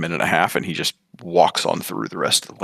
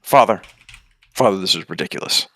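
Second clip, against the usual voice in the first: annoyed shout; 5 dB louder than usual. Acted. Credence shouts through the dome.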